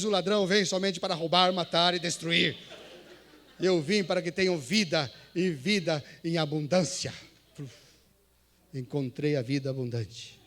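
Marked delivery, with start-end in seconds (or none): 0.0-7.1 loud, solemn proclamation; louder, emphatic Bible reading; scripture reading. Shown as a demonstration. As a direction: read out the verse with force. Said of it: "cheguei no versículo dez".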